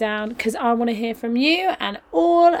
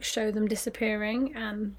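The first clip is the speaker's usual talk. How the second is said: usual speaking voice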